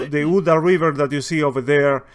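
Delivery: ordinary delivery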